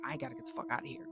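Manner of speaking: high, hushed, rushed panic; panicked. Retold or imitated. Boyfriend desperate to flee the haunted room, fast and panicky. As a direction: blurt it fast and high like bolting.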